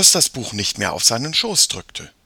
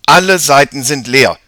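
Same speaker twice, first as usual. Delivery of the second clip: loud, higher, dismayed outcry; exclaiming; 4 semitones higher, 12 dB louder than usual. A narrator's reading. Jakob finds every page blank, loud exclamation. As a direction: exclaim it at full voice. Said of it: "rief er aus".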